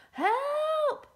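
very high, loud storybook yell; shouted cry for help. Acted. Emperor calling out as money turns into snakes, shouting. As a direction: cry out in exaggerated alarm. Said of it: "he shouted".

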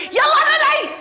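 furious shout. Acted. Mistress screaming at the maid to get out of the house, furious.

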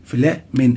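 "فلامين" punched on its second syllable, MIN; stress on middle syllable. Shown as a demonstration. Teacher pointing to the middle, second syllable stressed. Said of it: "هنا، هنا في النص أهي".

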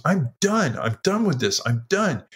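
high, exasperated insistence without shouting; retold upset outburst. Wife to husband in a barn fight, retold fed-up protest. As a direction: imitate a fed-up wife, punching each "done". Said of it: "she's crying and yelling".

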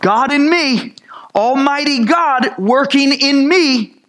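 high exultant pulpit cry; climactic preaching. Acted. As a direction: proclaim it at the sermon's peak. Climactic sermon exclamation.

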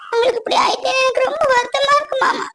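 tearful, high, breaking voice; crying. Acted. Wife crying to injured uncle-husband, tearful voice. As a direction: speak through tears.

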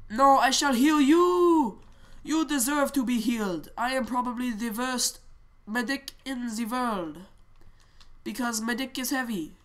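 hammed-up accented game medic; theatrical foreign-accented healer; medic with put-on accent. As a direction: play a grandly declaiming medic, drawing out some lines.

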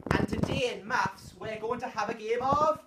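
loud, bright build to bingo; broad singsong kids theatre. Acted. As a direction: sell the game to little kids. Teacher hyping game to kids.

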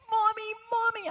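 imitating an excited little kid, high-pitched; elated child. Little boy calling his mother next morning, elated. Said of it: "He is elated. He's so just psyched".

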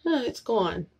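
usual speaking voice

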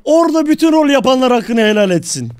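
loud, declamatory farewell; shouting. Acted. Roleplayer asking all players' forgiveness, shouting. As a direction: proclaim it at the top of your voice. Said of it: "fazla bağırma ya".

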